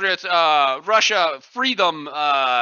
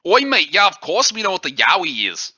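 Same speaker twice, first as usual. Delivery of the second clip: broad mock Australian "Oi, mate"; Australian accent. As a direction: switch into a jokey Australian accent. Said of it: "that is a very good accent".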